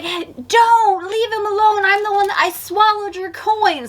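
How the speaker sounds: Ozzy defending his friend from the leprechaun; mock heroic kid. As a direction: shout it like a brave little boy. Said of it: "He's like".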